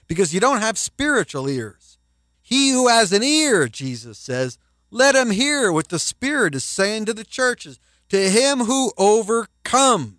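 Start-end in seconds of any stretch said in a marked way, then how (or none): 2.4-3.7 preacher proclaiming the verse, raised and emphatic; emphatic scripture. Acted. 4.9-10.1 preacher pressing the promise home; preaching emphasis. Acted. Repeated scripture, building emphasis.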